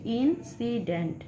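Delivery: ইনসিডেন্ট said slowly, syllable by syllable; slow syllable demo. Shown as a demonstration. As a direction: spell out ইনসিডেন্ট in three slow beats. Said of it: "দেখো তিনবার উচ্চারণ হচ্ছে". (ইনসিডেন্ট ধীরে তিন ভাগে উচ্চারণ)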